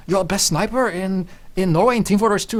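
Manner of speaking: high, loud amazed question; impressed fans. Retold or imitated. Indonesian guys amazed by the best sniper in Norway, high and loud. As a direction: gush like starstruck fans.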